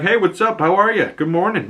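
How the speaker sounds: high-pitched chummy hello, easing down; mock friendly greeting. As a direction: imitate an eager greeting to a stranger. Peacemaker greets the silent alien.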